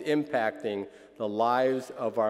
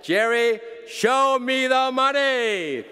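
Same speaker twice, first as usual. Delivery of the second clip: much higher, loud, slow shout; shouted movie line; 10 semitones higher, 6 dB louder, slower than usual. Acted. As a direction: belt it out like the movie. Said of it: "So I say to you".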